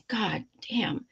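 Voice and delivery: breathy whispered God damn under the breath; whispered curse. Real, not acted. Fed up with family holiday dinners, a whispered curse. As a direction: hiss it to yourself in exasperation.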